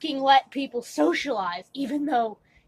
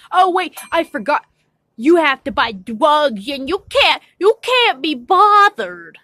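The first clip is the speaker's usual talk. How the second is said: sarcastic rant; 3 semitones higher, 6 dB louder than usual. Real, not acted. Mocking rant at the freeloading friend.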